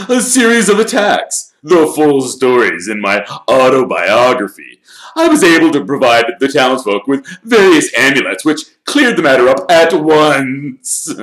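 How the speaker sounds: high, booming self-congratulation; pompous Lockhart. A narrator's reading. Lockhart telling everyone of his great deeds, pompous and loud.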